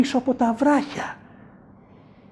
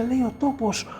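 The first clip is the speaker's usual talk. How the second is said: ordinary delivery, nothing marked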